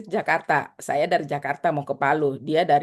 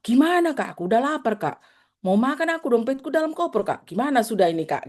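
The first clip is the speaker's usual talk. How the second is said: put-on higher, anxious girlish voice; pleading young girl; 5 semitones higher, faster than usual. Lost girl pleading with the teacher, higher and anxious. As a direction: whine that you're hungry and stuck.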